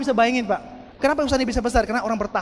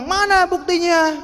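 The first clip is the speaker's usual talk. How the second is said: high, drawn-out demand for proof; mock challenge; 6 semitones higher than usual. Doubters demand proof of the car, high mocking challenge. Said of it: "Orang bilang".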